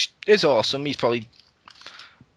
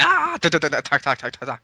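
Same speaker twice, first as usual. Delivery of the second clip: mock battle cry; faster than usual.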